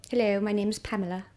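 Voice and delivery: mild posh nineteen-twenties English accent; lightly put-on posh English stage voice. Shown as a demonstration. Showing the co-host her posh English play role, mild posh accent. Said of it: "quite posh type voices".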